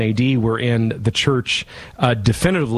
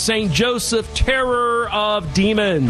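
raised, quick, solemn benediction; priestly blessing; 6 semitones higher than usual. Acted. Priest blesses radio listeners at show's end, solemn blessing. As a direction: bless the listeners like closing a Mass.

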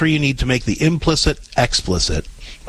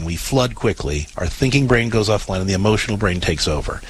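usual speaking voice

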